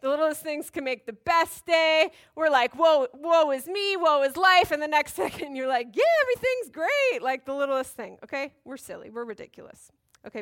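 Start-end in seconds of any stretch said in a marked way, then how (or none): 2.8-4.7 mock woe. Mocking people's self-pity. 5.9-7.2 high, loud burst of cheer; exaggerated giddy delight; mock elation. Mimicking a sudden mood swing.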